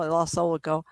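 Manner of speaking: ordinary talk, nothing marked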